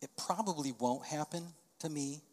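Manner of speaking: soft, calm fatherly comfort; gentle reassurance. Retold or imitated. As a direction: ease a frightened son, softly. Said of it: "I said".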